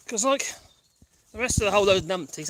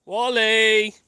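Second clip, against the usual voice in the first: loud, long carrying call; calling the dog; 2 semitones higher, 9 dB louder than usual. Real, not acted. Owner calls a dog out of sight, loud drawn-out call. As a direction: sing out the name across distance.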